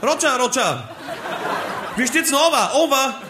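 high, laughing radio chatter; over walkie talkie. Acted. Funkdialog im Absturz, hoch. (Funkspruch, hoch und lachend)

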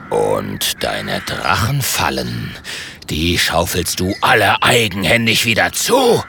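tiefes, gedehntes Drohen eines Drachen; herrischer Drache. Acted. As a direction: fahr ihn tief und langsam an. Drache befiehlt dem Ritter. (herrisch drohender, tiefer Drache)

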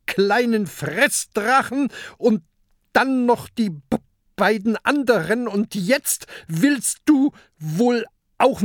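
high, loud stammering rant at a giant squid; little pirate scolding the kraken, hands on hips; furious tirade. A narrator's reading. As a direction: hurl insults up at the monster. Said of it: "ruft wutentbrannt".

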